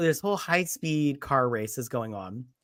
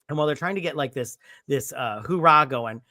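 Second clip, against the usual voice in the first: usual speaking voice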